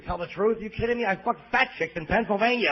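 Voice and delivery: ordinary talk, nothing marked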